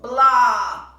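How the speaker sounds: big loud disgusted blah. A narrator's reading. Toad answers Frog with a loud fed-up blah. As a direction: blurt it out, fed up.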